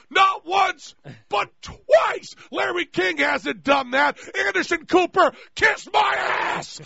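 high, shouted boasting rant; hammed-up gloating, punched out high; mock-triumphant excitement. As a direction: crow it at the rival hosts. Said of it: "you can even hear the excitement".

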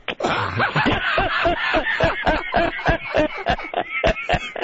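long, high peals of laughter; real belly laugh. Real, not acted. Host reacts to guest's front-or-back line, long real laughing fit. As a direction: lose it completely at a dirty joke. Cracking up at a dirty joke.

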